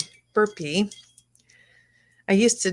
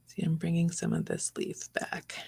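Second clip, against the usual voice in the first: whispering; 8 dB quieter than usual. Real, not acted. Whispering while painting.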